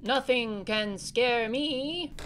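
a big hammed-up brag; mock fearless boast. Boy brags to his sibling about being fearless, slow mock boast. Boasting just before a scare.

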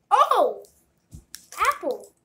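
loud, high surprised cry; surprised shout. Real, not acted. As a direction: blurt it out in surprise. Surprise at the flavor.

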